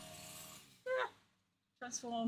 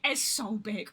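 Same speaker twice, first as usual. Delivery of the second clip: ordinary delivery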